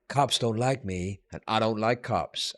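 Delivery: slow, lightly voiced movie villain; a hint of the sneering gangster, slow and quiet; Clarence Boddicker line. Retelling RoboCop to viewers, slow low-key villain impression. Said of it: "he'd go, what does he say? He says".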